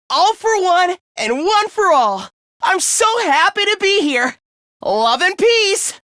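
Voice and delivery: high-pitched, joyful rallying cry; gushing idealism. Acted. New member so happy to be here, gushing to teammates. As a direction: gush with starry-eyed optimism.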